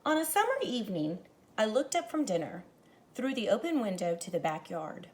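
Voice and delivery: expressive read-aloud to a class; story narration. A narrator's reading. Teacher reading a picture book to her class, expressive narration. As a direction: paint the evening for young listeners.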